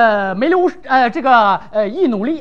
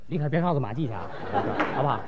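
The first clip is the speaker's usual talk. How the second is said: much softer, lower secret-telling; conspiratorial hush; 13 dB quieter, much faster than usual. Acted. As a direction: lean in and share gossip under your breath. Hushed gossip continued.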